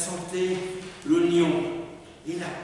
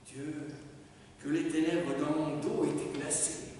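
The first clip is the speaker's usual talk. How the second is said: ordinary delivery, nothing marked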